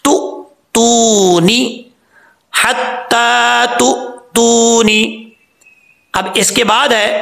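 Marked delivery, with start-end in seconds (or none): 0.0-5.0 हत्ता तु, तूनी slow, higher, with a clean glottal stop; तूनी drawn out with a crisp catch; correct recitation. Shown as a demonstration. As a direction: recite it slowly like in class. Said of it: "तो कैसे पढ़ेंगे".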